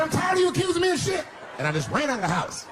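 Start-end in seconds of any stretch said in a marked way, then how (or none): 0.0-1.2 very high, loud indignant shout; husband blowing up at his wife, high loud shout; mock yell. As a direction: squeal it back in outrage. Husband yelling back at wife.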